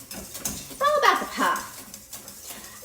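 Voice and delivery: soft, breathy whisper, slowed right down; whispered aside. Acted. To her live viewers about meringue, a slow secret whisper. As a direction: let it out as a secret, voice gone to air.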